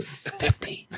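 hushed, voiceless counteroffer; imitated whisper. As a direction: whisper the figure like a stubborn negotiator. Retold negotiation, repeated whispered counteroffer.

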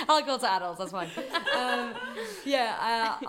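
loud, laughing through the words; laughing as she corrects herself. Real, not acted. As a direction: still giggling at her own joke. Laughing through her own joke.

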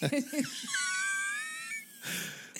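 shrieking high laugh; high squealing laugh. Real, not acted. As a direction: burst into a squeaky cackle. Cracking up after gooning joke.

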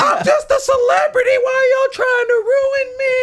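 hammed-up R. Kelly crying shout; mocking R. Kelly outburst. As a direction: wail it like a celebrity begging the press. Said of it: "the interview where they".

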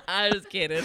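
high, loud, talking through big laughs; cracked up by your own joke; laughing hard. Real, not acted. Speaker jokes with the host, laughing hard.

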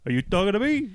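high-pitched New York tough-guy challenge; mock New York accent. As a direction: challenge them like a cartoon New Yorker. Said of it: "this is insulting to New Yorkers".

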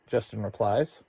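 ordinary delivery

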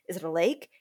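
normal talking voice

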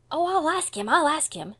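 put-on high, loud, hurried child's promise; quick. A narrator's reading. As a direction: give in hastily, alarmed. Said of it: "said Davy quickly".

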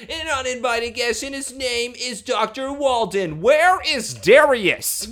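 hammed-up exasperation, pitched high; imitating an angry rich son; mock angry. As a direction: build to a high, drawn-out indignant demand. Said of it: "Lex is just angry".

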